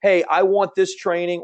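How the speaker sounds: ordinary talk, nothing marked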